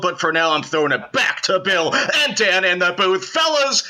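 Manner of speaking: high booming announcer voice; stadium announcer. Acted.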